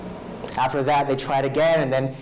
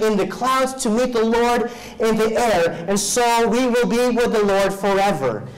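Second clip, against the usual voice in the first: raised, ringing preacher's voice, driving each verse home; full-force preaching; 7 semitones higher, 4 dB louder than usual. Acted. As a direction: proclaim the scripture to the congregation.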